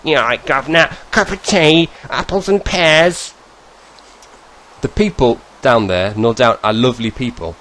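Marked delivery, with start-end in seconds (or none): 0.0-3.3 hammed-up Cockney, climbing higher through the run; mock a broad Cockney accent, last words stretched out. Mocking accents he can't stand, exaggerated broad Cockney. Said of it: "Broad Cockney accent".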